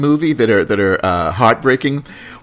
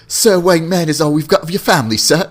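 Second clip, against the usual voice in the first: higher, quick pleading butler voice; Alfred; 5 semitones higher, 3 dB louder than usual. Retold or imitated. Butler insisting to his master on the manor, pleading impression. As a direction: plead like a devoted English servant. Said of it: "Alfred would be like".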